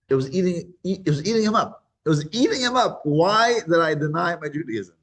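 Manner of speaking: high, insistent, building lament; anguished regret. Retold or imitated. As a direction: cry out the nagging question, high and stretched. Acting out man's anguished regret.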